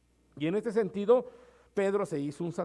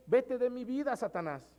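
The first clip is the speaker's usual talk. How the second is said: forceful command; 7 semitones higher than usual. Retold or imitated. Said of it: "se traduce".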